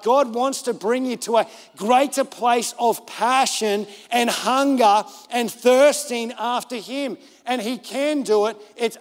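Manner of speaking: earnest pulpit exhortation; preaching appeal. Real, not acted. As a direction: press the appeal on the congregation, drawing out key words.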